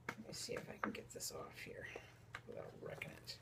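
very soft, near-whispered self-talk; absorbed murmur. Real, not acted. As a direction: murmur it under your breath while concentrating. Fiddling carefully with envelope.

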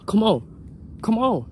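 usual speaking voice

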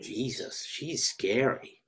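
quiet, slow hushed remark; hushed aside. Acted. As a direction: murmur it so Doris can't hear. Aside about Doris after she leaves.

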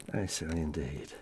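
soft, rueful murmur; wry aside. Acted. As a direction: concede it quietly to yourself. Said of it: "wry quiet aside".

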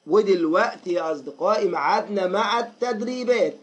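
ordinary delivery

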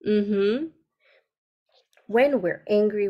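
normal talking voice

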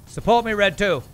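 loud impatient call; shouting at wingman. Real, not acted. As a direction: yell for your wingman to hurry. Yelling at slow wingman.

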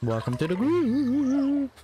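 high, slow mock greeting; silly high voice. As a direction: draw it out in a high goofy voice. Voicing a game greeting.

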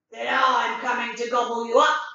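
loud, menacing troll voice; troll threatening the little goat; troll threat. Acted. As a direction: boom it out like a hungry troll.

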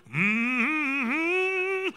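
long, high imitated gospel hum; gospel humming. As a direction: hum like funeral gospel singers. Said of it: "gospel singing women".

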